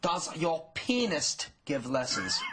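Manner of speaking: slow, pointed mother's voice; a hint of an indignant mom; stern mother. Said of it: "her mother stormed over and she said".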